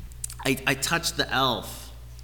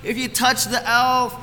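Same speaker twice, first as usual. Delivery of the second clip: high-pitched mock-upset child; imitated bawling son; 7 semitones higher, 3 dB louder than usual. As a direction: act out a little boy wailing about the rules. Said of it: "He's bawling his eyes out".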